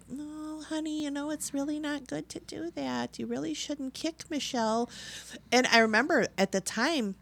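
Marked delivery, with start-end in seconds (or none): 0.1-4.9 sugary mom voice. Retold or imitated. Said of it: "the mom was always like".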